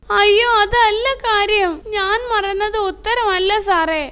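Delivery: high, tearful wail; loud wailing. Acted. Tindu protesting to the teacher, wailing through tears. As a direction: cry out the protest through sobs. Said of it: "വലിയ വായിൽ നിലവിളിക്കുന്നത്".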